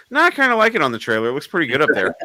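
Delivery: high, loud, playful brush-off; mock nonchalance. Acts out his evasive reply.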